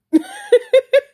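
big shrieking cackle of laughter; real loud high-pitched laugh. Real, not acted. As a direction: burst out cackling at the memory. Laughing at own story.

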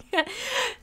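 high, soft laugh; excited giggle. Real, not acted.

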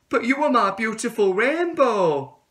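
cling to her with this line; tadpole pleading. Acted. Tadpole pleading with the caterpillar, high and loud. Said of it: "said the tadpole".

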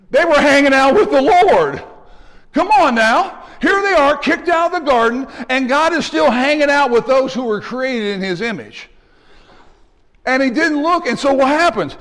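high, driven preacher's cry; loud, high pulpit exclamation; fired-up preaching. Real, not acted. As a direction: preach it out with rising excitement. Preacher bursts out mid-sermon.

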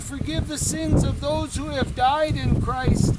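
ordinary delivery

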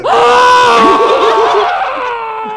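very high, loud shriek of laughter. Real, not acted. Player losing it over the clue, shrieking laugh. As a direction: burst into a long screaming laugh.

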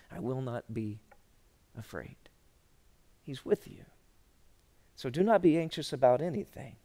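low, soft, unhurried reassurance; gentle, low pastoral voice; hushed, earnest preaching. Real, not acted.

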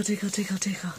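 whispered tickle tickle; playful whisper. Pretend. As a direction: whisper it teasingly to the baby. Tickling the baby.

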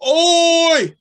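loud, high shout; boy shouting at the squirrel. A narrator's reading. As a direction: yell oi at the squirrel. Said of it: "said the boy".

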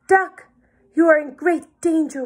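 ordinary delivery, nothing marked

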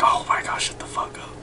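hushed, annoyed aside; voiceless grumble at screaming fans; exasperated whisper. Retold or imitated. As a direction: whisper it under your breath, fed up. Muttered reaction to screaming girls.

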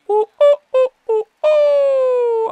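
very high, loud hoots with a long last one; high whooping. Real, not acted. Player celebrating being safe, whooping loudly. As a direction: hoot rapidly, then hold the last whoop. Whoops after reaching base.

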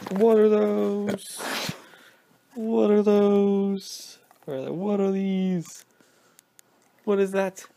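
high, loud, very slow "what are those"; slow meme voice. Pretend. Goofing at the dog with the slow high meme. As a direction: stretch it out like the meme.

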